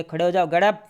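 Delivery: loud sharp order, खड़े हो जाओ barked out; commanding. Shown as a demonstration. Harsh teacher ordering a pupil to stand, loud command. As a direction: bark it like a strict command. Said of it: "और teacher ने क्या बोला".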